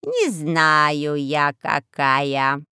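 low slow sly crow; raspy cawing crow. Acted. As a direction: drawl it like a sly old crow.

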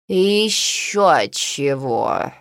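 low, very slow cat snub; curt cat. A narrator's reading. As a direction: cut it off like a cat. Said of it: "отрезала кошка".

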